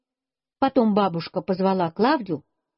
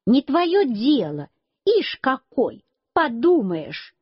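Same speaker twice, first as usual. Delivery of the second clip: mildly indignant yell, drawn out; understated scornful cry, stretched words; narrated snappy yelling; 4 semitones higher, slower than usual. A narrator's reading. Клавдия shouts back at the boy, mildly indignant. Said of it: "А Клавдия закричала на меня".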